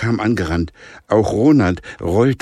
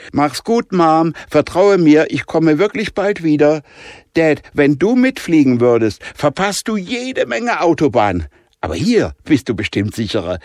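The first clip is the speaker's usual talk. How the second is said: higher and louder, cheerful farewell; girl calling goodbye; 8 semitones higher, 5 dB louder than usual. A narrator's reading. Daughter calling goodbye to her parents, cheerful girl. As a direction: call back as you run off.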